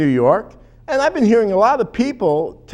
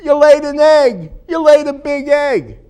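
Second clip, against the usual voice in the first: high, louder mock heckle; raised-voice heckler imitation; retold heckler yell; 7 semitones higher, 5 dB louder than usual. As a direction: imitate a heckler yelling from the audience. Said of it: "he starts yelling".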